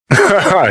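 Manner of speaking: short high chuckle; incredulous laugh. Acted. Laughing at V's idea, incredulous. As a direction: burst of disbelieving laughter.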